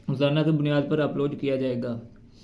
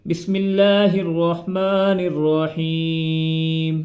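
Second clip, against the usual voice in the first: very slow, sung-out Quran recitation; drawn-out recitation with long vowels; melodic recitation; much slower than usual. Shown as a demonstration. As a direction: chant each word long and tuneful. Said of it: "کھڑی زبر کو بھی ٹھیک سے ادا نہیں کرتے".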